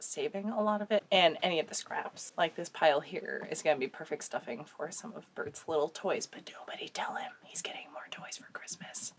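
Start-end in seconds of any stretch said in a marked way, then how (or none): 6.3-9.1 much softer, voiceless whisper; conspiratorial whispered aside; secret whisper. Real, not acted. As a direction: hush it so Bert won't hear. Keeping a secret from the dog.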